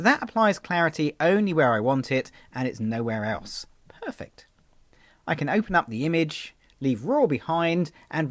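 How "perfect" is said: breathy whispered approval. Real, not acted. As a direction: whisper it, pleased. Quiet approval after edit.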